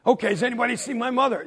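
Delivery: a hurried call to friends. Acted. Boy calls to lookout friends.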